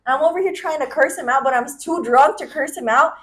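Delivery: normal talking voice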